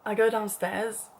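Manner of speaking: usual speaking voice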